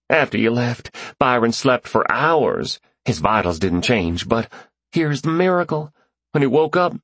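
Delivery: hardly holding in the joy; exhilarated. Acted. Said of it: "His voice was choked but exhilarated".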